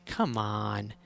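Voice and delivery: put-on hushed playful sweet-talk to an upset girlfriend; playful coaxing. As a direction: coax her gently like a tease. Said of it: "start being playful and humorous".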